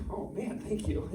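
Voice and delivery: a soft, sarcastic aside, half-breathed; mock sarcastic thanks. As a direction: hand back a dry thank-you for the insult.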